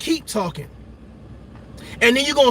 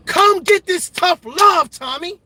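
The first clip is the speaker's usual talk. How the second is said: tough love call; 9 semitones higher, slower than usual. Real, not acted. Host calling out Tommy.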